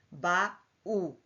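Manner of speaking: "Ba, u" split into two separate beats; syllable by syllable. Shown as a demonstration. Teacher showing syllables to the class. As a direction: break "baú" into two syllables. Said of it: "duas sílabas".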